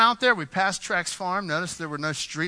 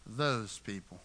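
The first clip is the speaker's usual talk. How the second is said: low, soft sneer; eye-rolling disdain; 7 semitones lower, 9 dB quieter, slower than usual. Retold or imitated. Nathanael looking down on Nazareth, soft sneering scorn. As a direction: say it like rolling your eyes. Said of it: "it's like he's rolling his eyes".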